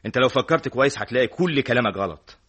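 loud, insistent appeal; heated interruption. Acted. Friend cutting in to be heard, heated and loud. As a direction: cut in forcefully, demanding to be heard.

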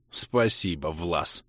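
low, subdued thanks; heartfelt-sounding thanks. A narrator's reading. Hero thanking friend for spreading word, subdued. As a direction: thank him with a sinking voice. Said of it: "от души поблагодарил я".

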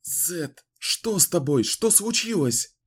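high, alarmed questions; frightened and urgent. Acted. Wizard speaks from trance. (в испуге)

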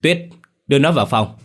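put-on husband snapping at his sister to take the boy away; barked order. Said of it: "Mạnh quát".

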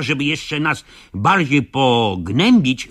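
ordinary delivery

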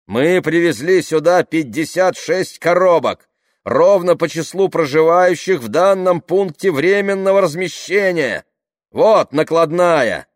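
loud, high official announcement; iron voice. A narrator's reading. Tamara showing the crowd the waybill, iron voice. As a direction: declare it forcefully to a whole crowd. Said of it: "железным голосом сказала Тамара".

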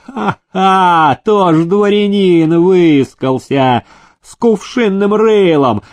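high, drawn-out jeering; bilious mockery. A narrator's reading. Nobleman mocking Demidov's granted title, bilious jeering. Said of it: "разразился желчным смехом".

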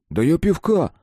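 high-pitched, protesting self-justification; defensive excuse. A narrator's reading. Man caught drinking by his friend, defensively excusing himself. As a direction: plead innocence with a rising swoop on the last word. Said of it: "оправдался он".

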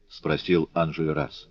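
usual speaking voice

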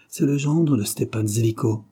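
ordinary delivery

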